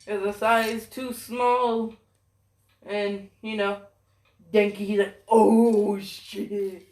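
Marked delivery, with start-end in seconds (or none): 5.3-6.9 loud, stretched exclamation dropping away; acted drawn-out dismay, punched then falling; stunned reaction. Acts Denki's stunned reaction.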